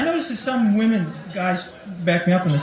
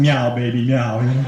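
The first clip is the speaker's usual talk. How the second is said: much lower, cool purring cat meow; hip cat voice; 6 semitones lower than usual. Acted. His hip cat greeting him at home, lower and cool. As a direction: meow like a laid-back hipster cat.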